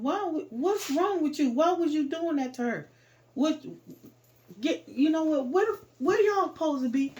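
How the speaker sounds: loud, high, outraged questioning; retold indignant dressing-down; angry scolding. Man confronts the boy, retold.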